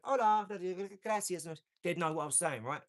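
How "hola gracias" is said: high, loud mock Latin accent; mock Latin greeting. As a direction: put on a Latin American greeting. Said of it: "If I went to Brazil and went".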